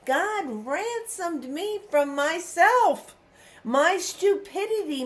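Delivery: impassioned testimony. Real, not acted.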